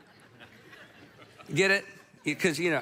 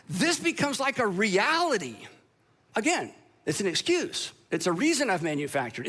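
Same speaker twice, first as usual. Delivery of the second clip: ordinary talk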